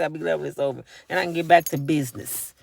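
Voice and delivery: ordinary delivery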